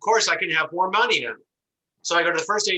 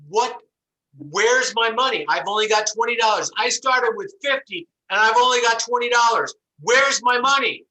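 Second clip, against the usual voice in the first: mock angry yell; 7 semitones higher, 4 dB louder than usual. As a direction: play the furious bank customer, louder. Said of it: "notice the anger in my voice".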